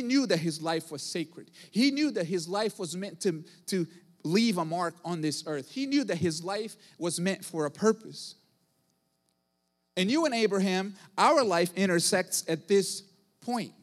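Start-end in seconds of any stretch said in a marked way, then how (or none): none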